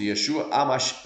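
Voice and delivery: usual speaking voice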